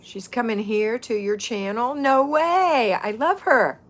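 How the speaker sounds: thrilled squeal, loud and high, peaking on "No way"; real happy excitement. Real, not acted. Host hears a favorite person is coming to the channel, real excitement. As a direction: burst out in delighted disbelief, loud and high. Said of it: "I'm so happy she's coming".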